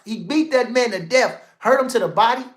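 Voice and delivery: ordinary delivery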